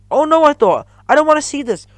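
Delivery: high, loud alarm; horrified. A narrator's reading. Narrator watching the mouse trap, horrified. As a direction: dread what's coming.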